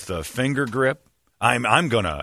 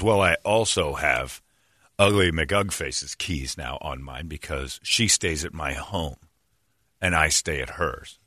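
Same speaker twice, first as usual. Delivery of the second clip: lower, slow sheepish confession; a hint of the lovesick boss owning up; lightly voiced boss, lower and slower; imitating boss; 6 semitones lower, 4 dB quieter, slower than usual. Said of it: "And he goes".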